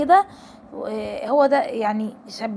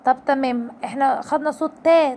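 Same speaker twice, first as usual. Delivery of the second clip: ordinary talk, nothing marked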